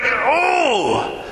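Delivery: sharp mock yelp at a price; a high put-on pained "Oh!"; mock ouch. Pastor mimicking his reaction to a toy price, high mock yelp.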